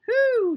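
high, loud drawn-out "whoo"; yelled whoop. A narrator's reading. Japhie cheers the banana sermon, yelled whoop. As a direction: whoop it out in delight. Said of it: "yelled Japhie".

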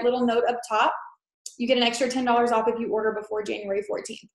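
usual speaking voice

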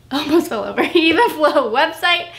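laughing after a stumble. Real, not acted. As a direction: giggle through the words. Nearly trips while talking.

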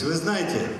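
ordinary delivery

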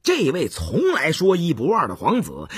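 ordinary delivery, nothing marked

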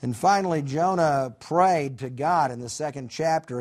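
ordinary talk

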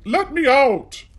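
high desperate shout. A narrator's reading. Trapped Aladdin calls to his uncle, shouting. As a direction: call out high and loud from the cave. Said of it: "Aladdin shouted".

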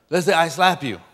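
normal talking voice

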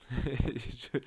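short nervous chuckle; nervous laugh. Real, not acted. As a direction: laugh out of sheer frustration. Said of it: "sinirden gülüyorum şu anda". (sinirden gülme)

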